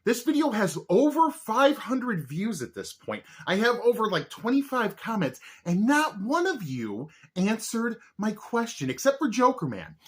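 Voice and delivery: high, loud mock indignation; mock outrage. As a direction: scold the viewers playfully. Mock rant at viewers.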